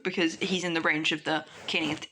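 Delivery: ordinary delivery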